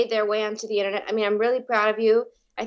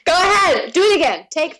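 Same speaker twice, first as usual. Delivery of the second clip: loud, high-pitched egging on; eager urging; 8 semitones higher, 6 dB louder than usual. Real, not acted. Co-host urging host to retry, loud and eager. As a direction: urge them to have another go.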